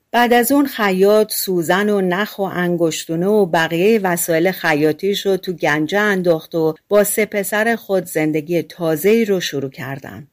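plain reading voice

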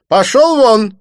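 high, loud "get out"; dismissive shout. A narrator's reading. Didier drives the waiter away, shouting. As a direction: bark it at the waiter. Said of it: "сказал ему Дидье".

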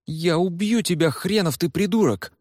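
high furious outburst; angry shout. Acted. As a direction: yell it like you mean to hit him. Ebel swings at Noah.

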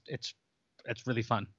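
ordinary delivery

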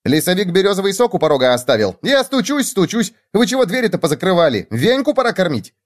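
high, hurried jabber; rapid chatter. A narrator's reading. Hare turning to the hamster with news, rapid chatter. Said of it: "затараторил".

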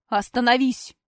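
loud sharp bark; barked stop. A narrator's reading. Heroine barks at brother to stop. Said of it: "Рявкнула так".

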